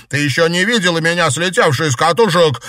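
loud, fast, high-pitched bark; barked retort. A narrator's reading. Enraged girl answering the frightened question, barked retort. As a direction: roar back a threat. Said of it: "рявкнула Наташа".

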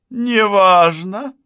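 НЕ ВАЖНО drawn out, high and loud, as a question; incredulous echo. A narrator's reading. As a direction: throw НЕ ВАЖНО back in high, slow disbelief. Outraged echo of the dismissal. (НЕ ВАЖНО — громко, высоко, с возмущённым недоверием)

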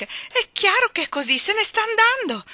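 high, loud anguished cry. A narrator's reading. Miss Lark seeing her dog leaving, crying out. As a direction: wail it loudly at the top of the range. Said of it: "gridò Miss Lark".

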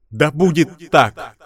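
loud, high divine wrath; booming goddess voice. Acted. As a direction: thunder it down as an angry goddess. (голос разгневанной богини)

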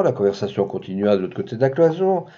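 plain reading voice

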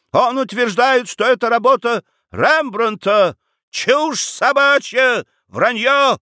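very high, outraged dismissal; heated scorn. Acted. Quarrel over the supposed Rembrandt, indignant. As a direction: rail against the claim, pitch soaring.

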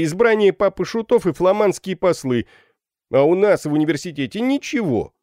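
high, loud voice of student grievance; complaining student. A narrator's reading. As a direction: rail at the authorities before a crowd. (возмущённая речь школяра)

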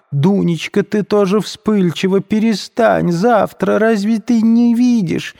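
high, slow frightened mother's voice. A narrator's reading. Мать в испуге унимает Дуню, высоким испуганным голосом. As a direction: hurry to calm her daughter in alarm. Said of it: "перепугалась мать".

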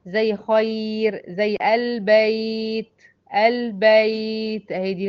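خير and البيت with the ي drawn out very slowly; teacher's slow soft-ya examples. Shown as a demonstration. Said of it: "اللينة هي برضو ساكنة".

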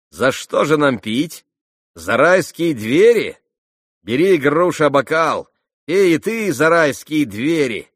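high, loud jeering voice; sneering young man, loud and high; mocking Rakitin. A narrator's reading. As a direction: tease her loudly, almost yelling.

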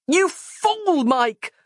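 very high-pitched, exasperated scream; frustrated scream. A narrator's reading. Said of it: "screamed Roz".

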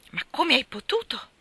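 high, loud shocked question; dismayed. A narrator's reading. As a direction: ask it aghast. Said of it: "chiese Demi sbigottito".

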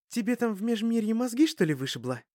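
high, fast, exasperated snapping; mock him for his silly question; couple bickering. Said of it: "если у нас семейная ссора".

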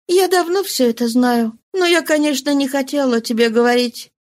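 lightly voiced consoling mistress.

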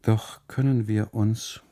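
ordinary delivery

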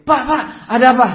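high, loud, fast urgent calls; acted breathless-haste panic; flustered, hurried. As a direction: rush in calling out in a fluster. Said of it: "Tergopoh-gopoh".

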